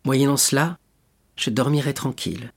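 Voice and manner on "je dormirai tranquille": plain reading voice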